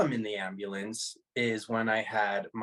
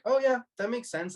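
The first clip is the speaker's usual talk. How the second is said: much higher, easy acceptance, retold; casual realization; 12 semitones higher than usual. Man retelling his out-of-body reaction, high casual realization. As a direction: act out a matter-of-fact "of course".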